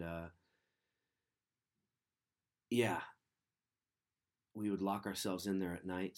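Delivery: ordinary talk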